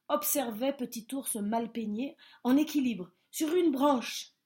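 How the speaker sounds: ordinary delivery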